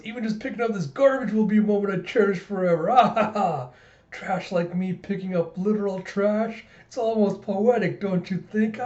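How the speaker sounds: high, hammed-up tragic lament; melodramatic. George picks up garbage on his last day, melodramatic. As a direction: play the self-pitying martyr to the hilt. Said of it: "this kid is so extra".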